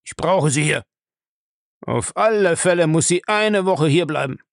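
loud, domineering demand; insistent old man. Acted. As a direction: press hard, leave no room for refusal. Bellamy insists she stays.

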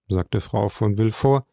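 ordinary delivery, nothing marked

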